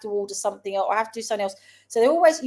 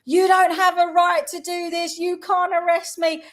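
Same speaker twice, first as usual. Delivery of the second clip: imitating a man yelling at police, pitched higher and louder; retold shout; 8 semitones higher, 4 dB louder than usual. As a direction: call out in protest while being arrested. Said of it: "he's calling out".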